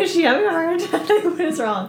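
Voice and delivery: high, laughing impression of a confused driver; mock bewildered driver. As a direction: play the puzzled driver, voice up. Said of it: "He's like".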